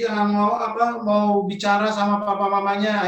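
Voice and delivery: normal talking voice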